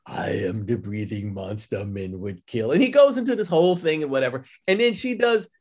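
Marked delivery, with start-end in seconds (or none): 0.1-2.7 slow, low whispered vampire confession; a hushed, menacing monster speaking to Mina; Dracula whisper. Retold or imitated. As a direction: breathe it like Gary Oldman's Dracula. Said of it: "and he's like".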